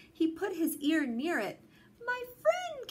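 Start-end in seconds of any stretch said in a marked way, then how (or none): 2.0-2.9 very high small faraway voice; tiny speck voice. A narrator's reading. Who on the speck thanks Horton, tiny high voice. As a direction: pitch it way up like a tiny person on a speck. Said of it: "came the voice".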